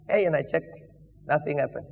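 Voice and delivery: ordinary delivery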